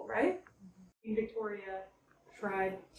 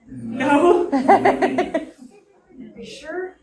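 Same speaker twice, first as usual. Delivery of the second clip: laughing tease; 5 semitones higher, 12 dB louder, much slower than usual. Real, not acted. Teasing guests about Ouija board.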